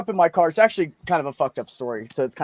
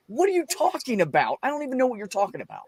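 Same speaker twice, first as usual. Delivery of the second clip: baffled protest; 7 semitones higher than usual. Retold or imitated. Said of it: "I was like".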